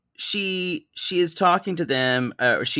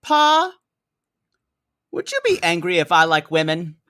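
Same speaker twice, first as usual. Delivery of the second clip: put-on prairie-girl voice, louder on Pa; Laura Ingalls Wilder; 2 semitones higher, 4 dB louder than usual. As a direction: sound like a prairie girl at bedtime. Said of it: "you just said it the way, like, Laura Ingalls Wilder".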